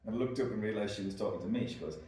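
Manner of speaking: ordinary delivery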